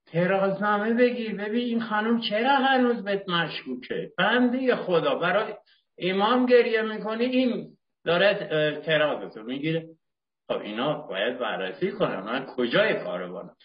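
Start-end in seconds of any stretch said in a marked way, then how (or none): 0.1-9.9 high, impassioned plea; fervent urging. Acted. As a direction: press the point hard, pitched up. Urging himself to take inventory.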